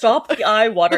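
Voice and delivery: loud robot command; robotic. Retold or imitated. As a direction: belt it out like a stilted robot. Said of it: "he is talking like a robot".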